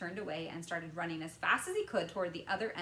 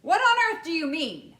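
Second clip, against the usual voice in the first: louder, higher demanding question; sharp question; 10 semitones higher, 16 dB louder than usual. A narrator's reading. Father challenging Wonka sharply, loud. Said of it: "asked Mister T V sharply".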